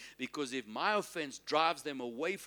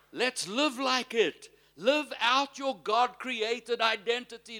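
high, loud preaching; impassioned exhortation; 6 semitones higher, 5 dB louder than usual. Real, not acted. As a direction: urge the congregation on, voice raised high.

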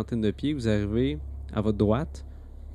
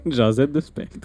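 laughing; 4 dB louder, slower than usual. Real, not acted. Laughing pun.

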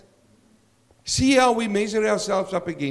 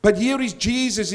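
normal talking voice

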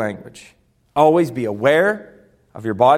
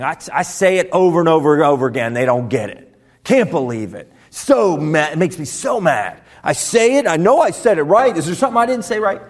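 acted frustrated rant; 3 dB louder than usual. Fed-up leader to team that doesn't get it, frustrated. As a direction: build from grumbling to indignant, hammed-up.

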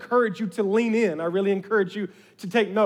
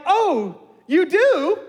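high, overdone "you do?"; hammed-up surprise; 8 semitones higher, 5 dB louder, much slower than usual. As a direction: fake amazement to trap someone. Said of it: "I'm gonna ham this up".

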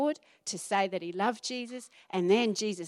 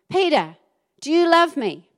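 louder, higher, insistent asking; Jesus asking Peter pointedly, loud and insistent; pressing question; 6 semitones higher, 9 dB louder than usual. Acted. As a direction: press the question a third time. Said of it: "Peter was a little bit upset by this time".

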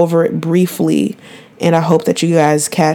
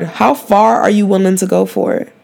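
ordinary delivery

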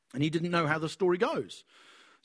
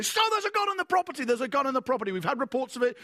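police questioning; 8 semitones higher, faster than usual. Retold or imitated.

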